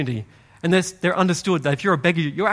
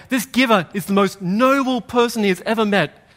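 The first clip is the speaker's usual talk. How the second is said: raised, higher proclaiming; loud proclamation; 5 semitones higher, 3 dB louder than usual. Acted. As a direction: announce it to the whole street. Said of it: "in a loud voice proclaim".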